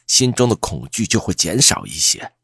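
ordinary delivery, nothing marked

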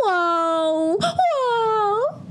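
high, drawn-out cartoon wail; hammed-up "waah" of a crying bear cub; mock bawling. A narrator's reading. Little bear rejected by the bee, high mock wailing. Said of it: "小熊又哭着走了".

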